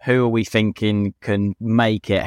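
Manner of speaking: ordinary talk, nothing marked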